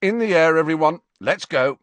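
rally the team with a loud command; brisk shouted order to the team; calling out orders. A narrator's reading. Captain orders the team to fly.

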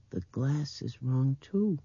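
high, slow dreaming voice; dreamy little girl. A narrator's reading. Blind girl at the window, high and dreamy. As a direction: drift slowly, rising high at the end. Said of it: "she said in that dreaming voice".

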